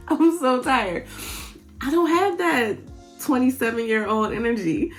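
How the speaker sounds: usual speaking voice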